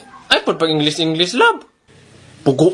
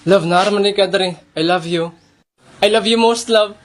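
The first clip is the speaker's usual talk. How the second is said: usual speaking voice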